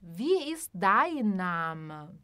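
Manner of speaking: slow, careful German question; teacher modelling informal German slowly; slow model phrase. Shown as a demonstration. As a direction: say it slowly for the class. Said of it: "E uma forma mais informal".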